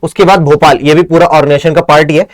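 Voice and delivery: ordinary delivery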